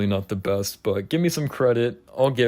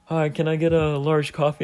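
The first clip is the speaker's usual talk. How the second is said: higher-pitched imitation of a customer; mock polite order, pitched up; drive-thru customer; 6 semitones higher than usual.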